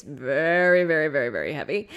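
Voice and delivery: first VERY stretched long and loud, the rest tumbling after. Acted. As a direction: lean hard on the first "very". Acting out lugging a heavy tent.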